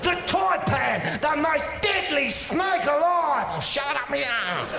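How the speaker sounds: high, shouted wildlife-presenter impression; Steve Irwin. Wildlife man presenting dangerous creatures to viewers, excited impression. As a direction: announce each deadly creature at a thrilled high yell. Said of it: "He is a man who found the most dangerous creatures".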